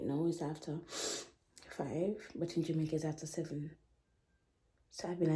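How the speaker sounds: soft, low, close narration; quiet voiceover. Real, not acted. To viewers, a quiet low recap of the trip. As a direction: tell it softly like a late-night recap.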